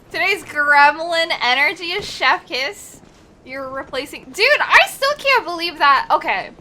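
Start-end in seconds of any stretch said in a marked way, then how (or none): none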